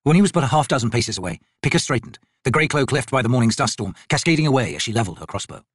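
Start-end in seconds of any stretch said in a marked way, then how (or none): none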